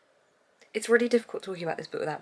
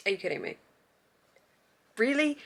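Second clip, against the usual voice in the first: exasperated disbelief; 4 semitones higher than usual. Retold or imitated. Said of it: "I just read it and was like".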